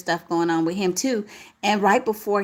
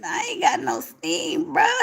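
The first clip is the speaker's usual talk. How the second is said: cracking voice; 7 semitones higher than usual. Real, not acted. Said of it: "You hear my voice crackling?".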